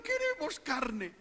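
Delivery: high, slow whining plea; whiny complaint. Retold or imitated. Preacher imitating Israelites demanding meat, slow high whine. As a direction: drag it out like a sulky crowd. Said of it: "Y ellos diciendo".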